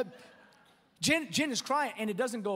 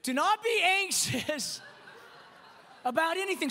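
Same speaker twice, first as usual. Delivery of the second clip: laughing; 6 semitones higher than usual. Real, not acted. Ironic punchline after vomit story.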